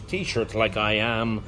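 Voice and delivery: ordinary talk, nothing marked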